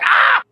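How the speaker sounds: high, sudden little yelp; mock startled yelp. Dog startled by the moving trash can, yelping. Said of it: "and they're like".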